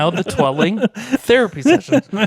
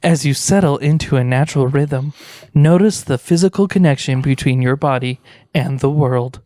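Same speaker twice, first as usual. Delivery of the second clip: guided meditation. Acted. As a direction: ease them into stillness.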